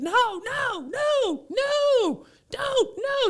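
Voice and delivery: put-on very high protesting child; toddler protest. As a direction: squeal no like a resisting kid. Toddler protesting diaper change.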